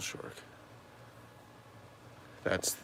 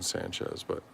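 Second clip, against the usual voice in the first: ordinary delivery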